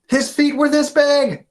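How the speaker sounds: high, loud, pleading insistence; insistent. Acted. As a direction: convince everyone, voice up high. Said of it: "says Ben".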